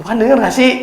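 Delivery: high, exasperated call to God; mock complaint. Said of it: "kita perlu teriak-teriak". (seruan kesal kepada Tuhan, nada tinggi)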